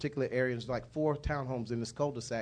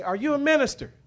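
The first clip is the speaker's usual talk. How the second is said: high, loud neighbour's demand; yelled question; 11 semitones higher, 9 dB louder than usual. Retold or imitated. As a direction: holler the question at him, pitched way up. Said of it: "she just yelled out".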